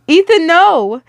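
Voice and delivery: high, loud cry of no; yell. A narrator's reading. Aunt yells at the boy running for the gift. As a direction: stop the boy with a sharp yell. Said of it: "I yelled".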